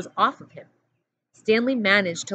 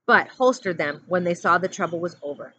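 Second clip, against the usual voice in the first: usual speaking voice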